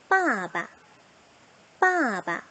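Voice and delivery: 빠바 with a long, full first syllable and a short, light second; neutral second syllable. Shown as a demonstration. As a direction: say 빠바 stressing 빠, letting 바 drop lightly. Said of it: "두 번째 있는 단어는 경성 처리가 되었습니다". (빠바의 둘째 음절을 짧고 가벼운 경성으로)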